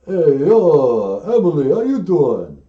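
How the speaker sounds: loud tough-guy caller impression; Sly Stallone. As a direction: do a loud, drawn-out action-star hello. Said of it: "Oh, it's Sly Stallone".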